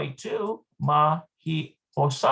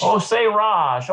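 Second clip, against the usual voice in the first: "Osei rash" slow and loud, stress on osei; slow, louder learner repeat of "Osei rash"; slow careful repeat; 4 semitones higher, 6 dB louder, slower than usual. Shown as a demonstration. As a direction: echo "Osei rash" slowly after the tape. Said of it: "repeating after the recording".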